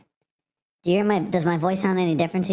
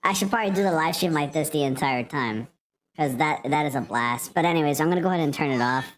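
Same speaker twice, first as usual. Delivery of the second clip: voice changer. Real, not acted. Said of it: "I'm gonna go back to the banana".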